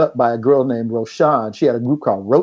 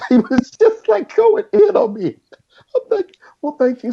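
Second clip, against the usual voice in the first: giddy laughter. Real, not acted. Giddy retelling of star's call.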